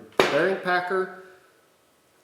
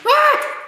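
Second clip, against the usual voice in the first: sudden high loud yelp of pain; sharp pain yelp; 11 dB louder than usual. Real, not acted. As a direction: yelp as if burned. Burned by hot bearing.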